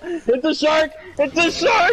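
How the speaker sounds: high yelling in panic; panicked shout. Real, not acted. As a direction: shout the warning. Shark attack in the game.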